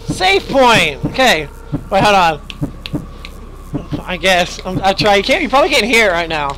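normal talking voice

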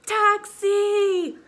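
high, loud hail for a cab; shout. A narrator's reading. Mama hailing a taxi, shouting. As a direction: call out long across the street. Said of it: "Mama shouts".

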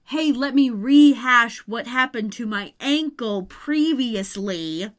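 loud, slow, laboured parody; mock clunky exposition. Mocking Phoebe's too-clunky fake-out dialogue, loud laboured parody. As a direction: announce it too loudly and deliberately, mocking the line. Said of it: "but not a".

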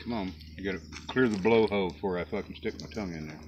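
ordinary delivery

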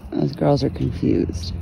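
ordinary talk

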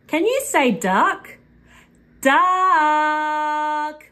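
ordinary delivery

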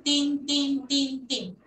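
tin tin tin tin chanted as a drill; repeated tin. Shown as a demonstration. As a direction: tap out tin, tin, tin, tin rhythmically. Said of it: "terminan su pronunciación en tin".

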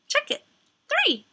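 high bright prompt. Acted.

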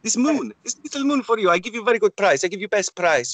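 a hint of a Moroccan trinket seller haggling; mock Moroccan trader. Street seller pitching trinkets to a tourist, mock Moroccan accent. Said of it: "I am going Moroccan".

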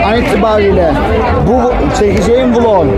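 loud, high, strained vlog voice; shouting over the crowd. Real, not acted. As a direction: shout it over concert noise. Konser sonrası gürültüde vlog kapanışı. (kalabalığın üstünden bağırarak)

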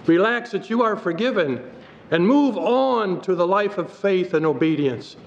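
usual speaking voice

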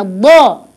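ضاء emphatic, heavy, pushed out; heavy emphatic dad. Shown as a demonstration. Teacher repeats the heavy ضاء with the child, emphatic. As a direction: make ضاء heavy and full. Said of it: "مفخم".

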